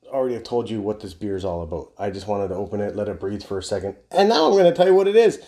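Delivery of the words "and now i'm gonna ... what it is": loud, high-pitched proclamation; sudden big, high announcer burst; mock-dramatic announcing. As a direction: belt it out high like a big reveal. Mock announcement of the beer details.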